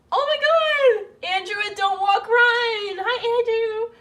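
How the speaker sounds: high, loud squeal of recognition; delighted greeting. Real, not acted. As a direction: squeal hello, stretching the first words. Spots a familiar donor's name.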